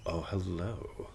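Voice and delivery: soft, playful welcome; friendly inner welcome. Shown as a demonstration. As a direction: coax the subconscious over softly. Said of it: "versus like".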